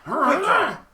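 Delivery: lightly voiced squeaky monster; high bugbear squeak. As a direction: squeak it like a startled creature. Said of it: "and he's like".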